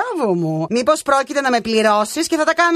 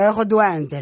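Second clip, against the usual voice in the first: usual speaking voice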